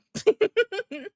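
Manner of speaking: high burst of laughter. Real, not acted. Streamer laughing at a chat comment, a high giggle.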